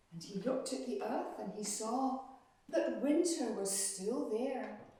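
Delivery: ordinary delivery, nothing marked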